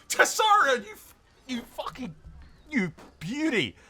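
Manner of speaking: loud, high, laughing triumph; elated cheer. Real, not acted. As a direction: cheer your dragon on in overjoyed disbelief. Celebrates dragon's surprise win.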